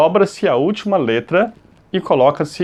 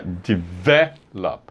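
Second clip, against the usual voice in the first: develop broken up slowly, de-VEL-op, lower; exaggerated middle stress; 8 semitones lower, 4 dB quieter, much slower than usual. Shown as a demonstration. Teacher spelling out the strong syllable, develop stressed slowly on VEL. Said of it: "a sílaba forte é essa aqui".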